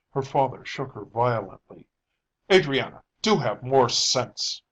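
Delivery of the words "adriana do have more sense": exasperated father's cry; cried out. A narrator's reading. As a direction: scold her sharply, drawing the words out. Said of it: "he cried".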